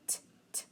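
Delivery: t as a voiceless tap, no vowel; isolated t sound. Shown as a demonstration. Teacher sounding out letters to spell, soft voiceless t. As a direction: tap out "t" twice, very softly. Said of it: "So I have".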